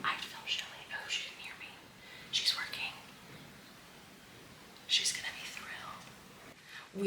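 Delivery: hushed whisper so she can't hear. Real, not acted. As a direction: whisper it, someone's working nearby. Whispering so Shelly won't hear.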